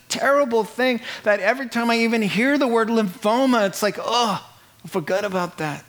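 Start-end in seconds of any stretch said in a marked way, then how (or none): none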